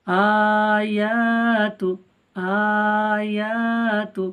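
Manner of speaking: আয়াতু chanted very slowly, the alif held long; drawn-out recitation. Shown as a demonstration. The teacher recites আয়াতু to viewers, drawn out. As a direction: recite আয়াতু, stretching the madd. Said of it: "এক আলিফ টান হয়".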